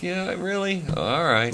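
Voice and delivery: soft, high doubtful query settling into resignation; reluctant agreement. Retold or imitated. Bandmate giving in after losing the vote for the Cadillac, reluctant. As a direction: quietly give in, unconvinced.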